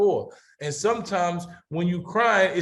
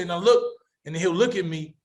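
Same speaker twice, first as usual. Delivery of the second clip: normal talking voice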